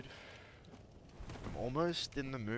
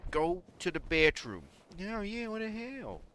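high-pitched, exclaiming imitation; put-on falsetto of a woman; imitating a woman; 9 semitones higher, 7 dB louder than usual. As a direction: push it up high and loud, mock outrage. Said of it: "'cause she swears".

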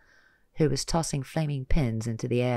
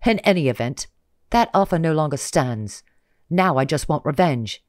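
loud, higher menacing vow; vengeful villain; 4 semitones higher, 7 dB louder than usual. Acted. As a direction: promise revenge coldly. Villain declares revenge.

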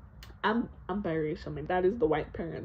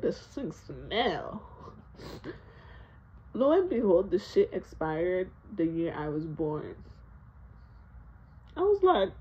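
usual speaking voice

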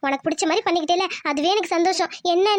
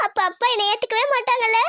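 higher, sobbing voice; crying; 4 semitones higher than usual. Acted. Daughter fearing her father will never accept her, crying. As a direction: break into sobs while speaking. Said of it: "அழுகாத".

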